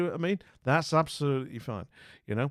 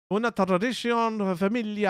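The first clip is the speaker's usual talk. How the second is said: hammed-up Italian accent, higher pitched; bad Italian accent; 7 semitones higher, 3 dB louder than usual. Streamer reading a Spanish sign aloud, in a bad Italian accent. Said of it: "I'm doing an Italian accent".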